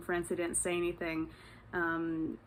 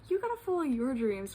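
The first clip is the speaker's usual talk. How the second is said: higher-pitched echo, leaning on the opening words; hyping back; 5 semitones higher than usual. Retold or imitated. Speaker returns the stranger's encouragement, retold hyped-up echo. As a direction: toss the pep talk back, pitch starting high and falling. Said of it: "we were hyping each other up".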